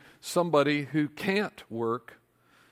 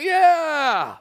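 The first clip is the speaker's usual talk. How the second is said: high, loud whooping yeah; crowd cheer; 12 semitones higher, 9 dB louder than usual. Acted. Writers cheering the raise, loud high yell. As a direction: cheer like a stadium. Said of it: "They went".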